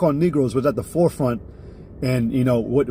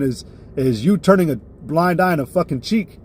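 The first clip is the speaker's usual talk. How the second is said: normal talking voice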